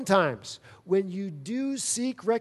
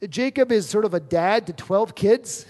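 ordinary talk, nothing marked

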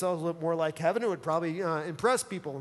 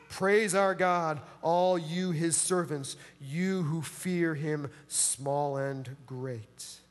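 solemn scripture reading; 3 semitones lower, much slower than usual. Acted. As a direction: let the voice of the throne sink to a hush. Reads scripture slowly, fading.

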